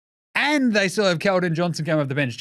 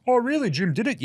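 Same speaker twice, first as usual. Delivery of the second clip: sarcastic; 8 semitones higher than usual. Retold or imitated. Mock sarcastic aside.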